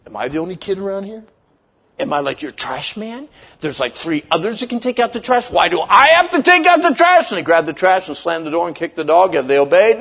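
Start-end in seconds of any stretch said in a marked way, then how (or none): 0.1-7.3 put-on indignant kid. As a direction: complain like a put-upon kid, rising to a shout. Acting out resentful kid told to do chore.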